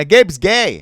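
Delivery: loud, high yell; scream. Shown as a demonstration. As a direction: scream it out like a catchphrase. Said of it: "just scream".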